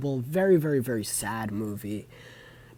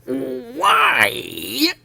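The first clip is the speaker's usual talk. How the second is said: much higher, loud, wailing mock cry; Neil Hamburger impression; 13 semitones higher, 9 dB louder than usual. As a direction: wail the word like a miserable comic. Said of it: "I have to do the impression now".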